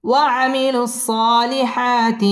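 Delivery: slow, drawn-out melodic recitation; Quran recitation. Shown as a demonstration. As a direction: chant it slowly, tajweed style. (ধীর সুরেলা কুরআন তিলাওয়াত)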